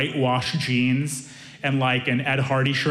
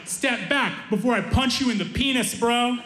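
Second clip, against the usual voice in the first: higher-pitched mock tough guy; clumsy tough threat; 8 semitones higher than usual. Physicist little brother confronting a driver, awkwardly tough. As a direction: threaten like someone who has never fought. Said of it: "he hadn't, like, workshopped any of his insults".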